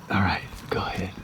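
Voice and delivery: whispered go-ahead; whispering. Real, not acted. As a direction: whisper the cue. Quietly cueing during filming.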